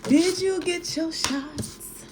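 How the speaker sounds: mock R. Kelly asking about the trip; R. Kelly impression. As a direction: drawl the questions out slow and high like R. Kelly. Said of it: "Like what R. Kelly say".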